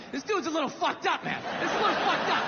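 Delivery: lightly voiced kid sizing up dad; mock wary toddler aside; toddler's dawning realization. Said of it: "She's starting to put it together like".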